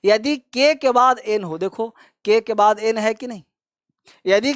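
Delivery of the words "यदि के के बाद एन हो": normal talking voice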